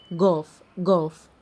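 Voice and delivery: جاف with a hard g; Persian gaf sound. Shown as a demonstration. Teacher showing the barred kaf, جاف said with hard g. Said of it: "بتنطق جاف".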